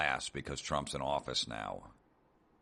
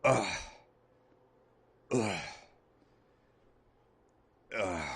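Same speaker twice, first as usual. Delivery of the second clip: fading groans of dismay; mock disgusted groans; 4 semitones higher, slower than usual. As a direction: imitate the women's sighing ughs. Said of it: "And you just hear".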